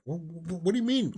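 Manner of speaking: stammering, high-pitched disbelief; imitated baffled protest, pitch climbing; mock incredulous critic. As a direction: splutter as if the question is absurd. Acts out critics' incredulous reply.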